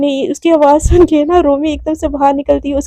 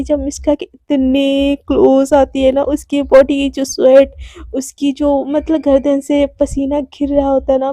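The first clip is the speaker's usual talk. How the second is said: ordinary delivery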